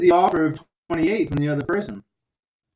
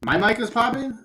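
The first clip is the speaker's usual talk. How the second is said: crackly usb mic; 7 semitones higher than usual. Real, not acted. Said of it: "Your mic's popping".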